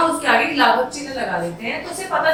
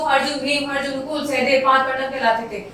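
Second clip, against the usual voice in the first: names run together, no breaks; rushed without pauses. Shown as a demonstration. Teacher showing children a list read without any pause. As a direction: rattle the names off in one go. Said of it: "अब मैं direct बोल दूं".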